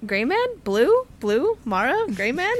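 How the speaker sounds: names tossed out with rising, questioning tunes; comic double-takes, each name lifted high; mock surprised greetings. Acting out characters' startled name exchange.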